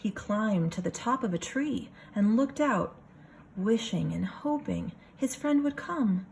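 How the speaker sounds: lively picture-book reading voice; story narration. A narrator's reading. Teacher reading a picture book to her class, storytelling voice. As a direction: read it for a room of kids.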